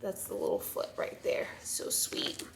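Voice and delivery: hushed, whispered coo; tender whisper. Real, not acted. Mother admiring the little foot right there, tender whisper. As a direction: whisper it adoringly, almost to yourself.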